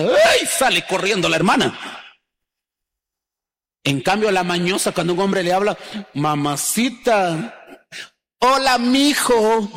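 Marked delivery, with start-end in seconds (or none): 0.0-0.5 very high, louder mock squeal of fright; a sudden high-pitched mock "ay" of alarm; startled shriek. 6.1-9.7 slow, coy, drawn-out flirt, rising high; hammed-up come-on, slow and higher.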